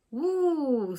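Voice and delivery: high startled whoop; great surprise. A narrator's reading. Twiddle startled by fireworks, high surprised whoop. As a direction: yelp as the rocket goes off. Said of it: "in the greatest surprise".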